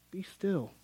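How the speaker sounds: softly calming Moses. Acted. Moses calms the frightened people.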